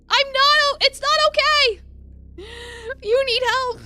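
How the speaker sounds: high, loud desperate protest; panicked cry. Acted. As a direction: refuse to accept it. Panicked protest at his wound.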